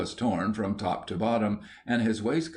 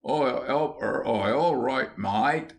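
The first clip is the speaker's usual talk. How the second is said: effortful copy of Cockney, pitched up; imitated Cockney; 4 semitones higher than usual. A narrator's reading. William copying his new friend, imitated Cockney. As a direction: try hard to sound like a Cockney mate. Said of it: "making an effort to acquire his new friend's accent and intonation".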